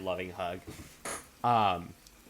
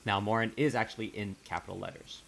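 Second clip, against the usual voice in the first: ordinary delivery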